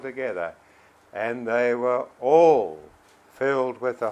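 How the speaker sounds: loud, emphatic Bible reading; scripture reading. Acted. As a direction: proclaim the verses with firm, punched key words. Reads scripture aloud to congregation.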